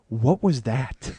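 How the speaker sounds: slow, laughing question; laughing disbelief. Retold or imitated. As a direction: ask it while cracking up in disbelief. Cranston laughing at his chest-slap.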